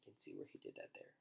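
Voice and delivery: quick soft whisper; whispered aside. Real, not acted. Reader pointing at the picture, fast whisper.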